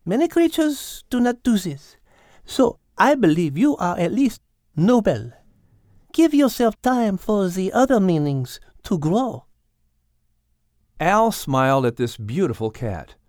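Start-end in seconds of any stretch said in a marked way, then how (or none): 0.0-9.5 high, refined feline lady; elegant cat voice. Acted. Cat praising Al's nobility, refined high voice. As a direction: speak as a gracious cat.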